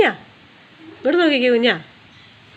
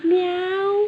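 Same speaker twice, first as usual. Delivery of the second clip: high, drawn-out meow; cat meow; 9 semitones higher, much slower than usual. Shown as a demonstration. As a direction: imitate a cat in a high-pitched voice. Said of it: "പൂച്ചയ്ക്ക് എങ്ങനെയാ കരയുന്നേ". (പൂച്ചയുടെ മ്യാവ് ശബ്ദം)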